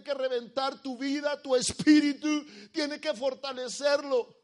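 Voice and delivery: high-pitched, forceful pulpit delivery; preach. Real, not acted. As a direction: preach it hard, punching the key words loud.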